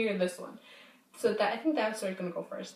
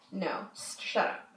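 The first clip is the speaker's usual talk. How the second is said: hushed brush-off; 3 semitones lower, 5 dB quieter, slower than usual. Retold or imitated. Retold quarrel with friend.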